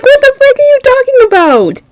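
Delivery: high squeal of confusion; shocked disbelief. Retold or imitated. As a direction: yelp it in outraged disbelief.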